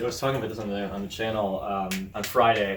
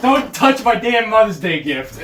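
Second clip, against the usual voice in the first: slow, louder mock scolding mom; mom's scolding; 8 semitones higher, 6 dB louder than usual. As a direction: scold like a mom guarding her gift. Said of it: "And she's like".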